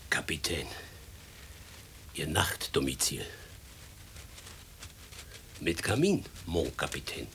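soft unvoiced whisper; a hushed, secretive whisper. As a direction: whisper it as if not to wake anyone. Servant shows captain his room.

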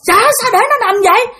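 high, urgent question; calling out alarmed. Retold or imitated. Neighbour seeing child lying there, calling out alarmed. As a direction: imitate a man startled and concerned. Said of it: "mới kêu rằng".

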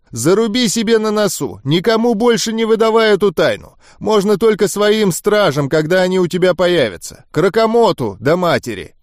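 insistent high-pitched warning; stern irritated boss. Acted. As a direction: drum the order in, pitched well up. Voices the boss giving orders. (строгий начальник внушает, высоким голосом)

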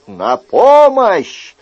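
loud, slow drawn-out cry for help. Acted. Chicot shouting for help through the storm, loud and slow. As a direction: yell it out with all your strength. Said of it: "Шико принялся изо всех сил кричать".

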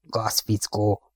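grind it out slowly through gritted teeth. A narrator's reading. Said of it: "csikorgatta a fogát a kapitány". (fogcsikorgatva, halkan, lassan)

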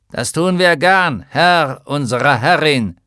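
loud, metallic armored-giant voice; tinny giant voice. Acted. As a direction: boom it out like a hollow iron giant. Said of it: "mit blecherner Stimme".

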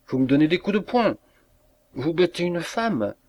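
high, acted outrage; indignant protest. A narrator's reading. Usherette protests at being hit.

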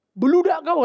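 the prophet snapping at the crowd, loudly; John's rebuke. Retold or imitated. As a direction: fling the insult at them.

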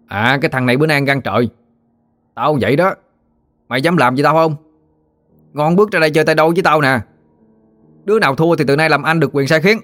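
acted bully daring a rival; challenging gang leader. A narrator's reading. Bully daring a smaller boy to fight, cocky challenge.

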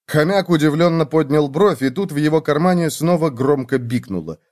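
ordinary delivery

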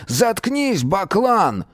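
high furious yell; angry shout. A narrator's reading. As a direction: snap at him to shut up. Miron shouting at the lump.

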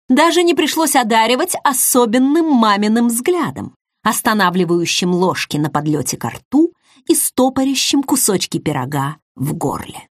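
usual speaking voice

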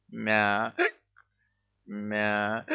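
cut-off cat cries with hiccups; hiccuping meow. A narrator's reading. Cursed cat's meowing mixed with hiccups, hiccuping meow. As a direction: break each meow with a hiccup. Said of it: "нервной икотой".

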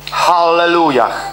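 slow, drawn-out Hallelujah; proclaimed Hallelujah. Shown as a demonstration. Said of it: "I kiedy mówisz hallelujah".

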